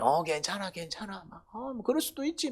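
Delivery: high, breezy easygoing voice, shrugging it off; carefree idler. Retold or imitated. Preacher imitating an idle man brushing off mistakes, breezy and high. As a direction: play the relaxed idler who forgives everything.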